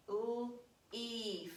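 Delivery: "l-" split off, then "eef" with a long ee; teacher showing the silent A; sounding out. Shown as a demonstration. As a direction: segment "l-, eef" slowly. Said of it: "E some company in this word".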